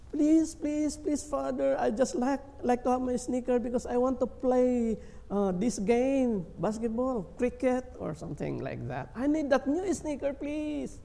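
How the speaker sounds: put-on high, whiny pleading child's voice; begging child. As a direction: beg like a kid who won't take no for an answer. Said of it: "you as a child, you go to the father".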